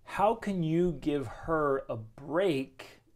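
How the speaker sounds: ordinary talk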